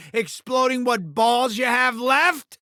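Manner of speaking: ordinary talk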